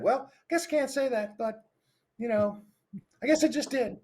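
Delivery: high, squeaky cartoon mouse; sheepish cartoon mouse catching himself; Mickey Mouse voice. Coach admitting a slip as Mickey Mouse. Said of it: "using the Mickey Mouse voice".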